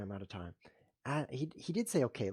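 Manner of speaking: usual speaking voice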